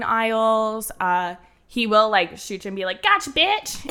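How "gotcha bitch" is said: Chappelle gloating. Retold or imitated. Chappelle to a laser-tag foe, high gloating taunt. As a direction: crow it after the shot. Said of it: "I can hear his voice in my head doing that".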